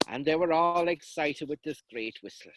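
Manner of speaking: ordinary delivery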